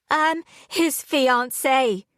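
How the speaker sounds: trying to sound angry but it comes out shaky; shaky and emotional. A narrator's reading. Fiancée to his father, hurt and shaky. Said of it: "came out shaky and emotional".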